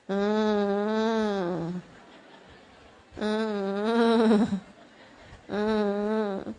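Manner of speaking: low droning fake whine; long low hum of a child barely bothering to cry; imitated half-hearted crying. Preacher imitating a child's weak crying, droning whimper. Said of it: "nangisnya kayak gitu".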